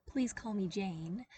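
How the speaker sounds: usual speaking voice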